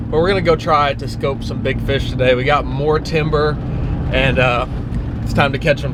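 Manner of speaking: ordinary talk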